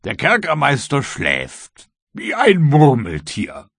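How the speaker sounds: slow, high, loud character voice; gleeful servant. A narrator's reading. Croxton reveals the jailer's fate, slow and loud. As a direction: draw it out with loud relish.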